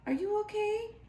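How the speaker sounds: ordinary delivery, nothing marked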